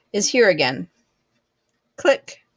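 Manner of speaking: ordinary delivery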